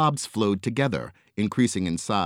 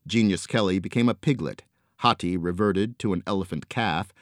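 usual speaking voice

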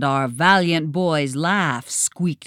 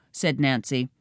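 plain reading voice

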